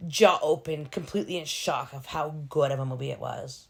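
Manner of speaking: ordinary talk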